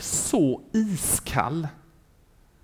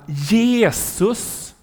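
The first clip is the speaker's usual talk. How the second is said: drawn-out, higher call; retold cry; 5 semitones higher than usual. A man in hell calls a second time, higher drawn-out cry. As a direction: imitate calling out, stretching the name upward. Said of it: "Så han ropar".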